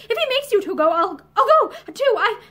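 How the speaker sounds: high, loud, agitated outburst; excited, breaking off abruptly. A narrator's reading. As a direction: stumble over the words in agitation. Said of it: "in the same high, excited voice".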